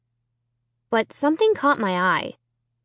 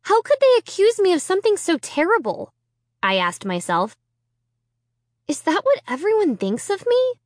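hurt; 6 semitones higher than usual. A narrator's reading. Inner hurt monologue.

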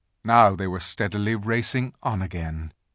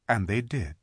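ordinary delivery

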